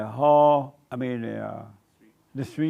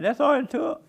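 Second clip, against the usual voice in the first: laughing; 12 semitones higher than usual. Real, not acted. Amused punchline after stalker leaves.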